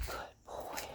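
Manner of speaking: very soft, whispered dog praise; hushed praise. Real, not acted. Trainer to the nervous dog, hushed praise. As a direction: barely voice it, just for the dog.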